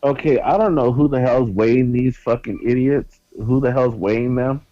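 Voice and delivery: loud, high, exasperated disbelief; high-pitched, loud outburst of incredulity; incredulous rant. Real, not acted. Mock-outraged rant about billed weights.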